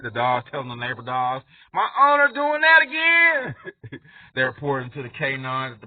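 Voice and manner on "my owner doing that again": high, loud, slow dog voice; high, tattling dog voice; gossiping dog. Acted. Dog telling on its owner.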